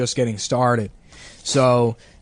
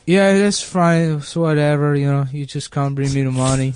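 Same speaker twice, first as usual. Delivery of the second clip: put-on Mexican accent. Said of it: "like, Mexican guy showed me the place".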